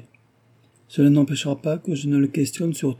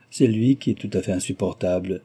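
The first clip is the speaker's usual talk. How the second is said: ordinary delivery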